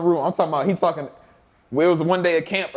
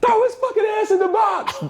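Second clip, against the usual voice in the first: much higher, slow comic coach shout; Saban yelling. Retold or imitated. Saban ordering the player into the box, in a high comic shout. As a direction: bellow the order like a furious head coach.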